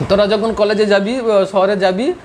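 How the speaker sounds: ordinary talk, nothing marked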